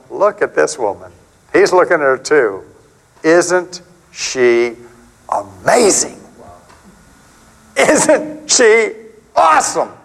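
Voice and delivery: a tender, marvelling Jesus, rising to delight; admiring Jesus voice. Retold or imitated. Jesus marveling at the woman to Simon, rising from hushed awe to delight. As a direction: let the wonder grow from hushed to bright.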